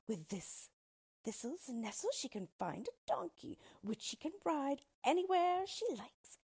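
boastful storybook dwarf, swinging high; jaunty dwarf. Acted. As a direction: show off to the mother-in-law.

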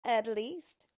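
loud, gloating dwarf voice; spiteful dwarf. A narrator's reading. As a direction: jeer at your victim. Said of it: "began to laugh spitefully".

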